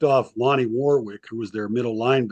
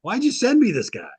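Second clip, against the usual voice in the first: higher, fed-up complaint; exasperated complaint; 10 semitones higher than usual. Retold or imitated. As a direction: vent about the troublemaker. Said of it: "Perry Moss finally calls Jack Pardee and says".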